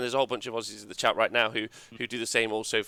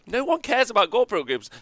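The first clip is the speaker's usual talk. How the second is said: mock retort; 10 semitones higher, 3 dB louder than usual. Retorting to the podcasters.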